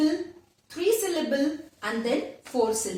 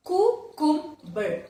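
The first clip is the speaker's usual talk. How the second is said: "cucumber" split as cu, cum, ber; syllable by syllable; 3 semitones higher, much slower than usual. Shown as a demonstration. Teacher shows the third one, syllable by syllable. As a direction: break "cucumber" into three spaced beats. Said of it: "three syllable".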